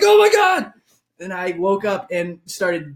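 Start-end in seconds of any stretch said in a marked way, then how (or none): 0.0-0.7 very high, loud mock horror; imitated shriek of horror; horrified scream. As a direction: scream it in comic shock. Dreamer's horror acted out.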